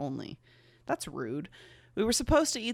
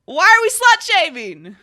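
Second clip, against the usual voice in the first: higher and louder mock protest; mock outrage; 11 semitones higher, 16 dB louder than usual. Real, not acted. Reader protesting the story in mock outrage. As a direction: cry out in mock indignation.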